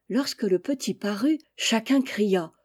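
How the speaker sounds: ordinary delivery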